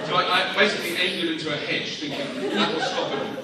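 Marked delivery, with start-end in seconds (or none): none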